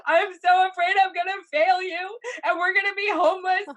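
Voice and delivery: high, loud, tearful panic outburst; hammed-up hysterical meltdown, voice shooting up. Wife melting down to her husband on the stairs, hysterical. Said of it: "I mean, I'm hysterical".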